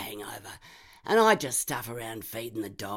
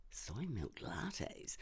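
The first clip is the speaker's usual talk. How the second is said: disbelieving; 12 dB quieter, much slower than usual. Acted. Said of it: "Who gave a shit".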